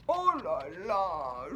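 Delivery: slow, low weary groan. Acted. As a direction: moan it out, worn out. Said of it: "gémit Monsieur Endormi".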